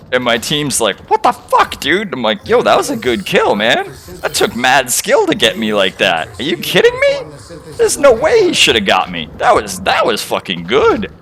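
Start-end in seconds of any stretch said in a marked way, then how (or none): 1.1-2.1 high-pitched outburst of mock disbelief; incredulous teammates. Teammates reacting to his praise of an enemy, mock incredulous. 2.5-11.1 high, excited re-enactment of admiring protest; hyped-up praise. Retold or imitated. Player defending an enemy's kill to his teammates, acted-out excited admiration.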